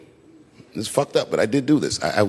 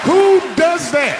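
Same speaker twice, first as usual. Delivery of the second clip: much higher, louder, slow and stretched; outraged disbelief; 8 dB louder, much slower than usual. Real, not acted. Outraged rhetorical question.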